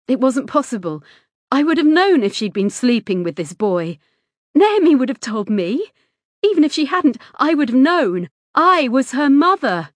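loud, high, mounting protest; indignant disbelief. A narrator's reading. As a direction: protest with rising outrage. Mother's disbelieving inner protest.

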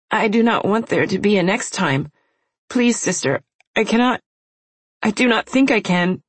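put-on high, trembling plea of a little girl; pleading young girl. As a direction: beg like a frightened child.

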